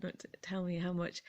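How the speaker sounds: urgent hushed whisper; whispered plea. Retold or imitated. The shop lady begging the speaker for the price, whispered. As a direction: whisper it like a secret you must know.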